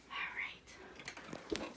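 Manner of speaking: hushed aside; whisper. Real, not acted. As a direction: quietly whisper it. Whispered aside after song.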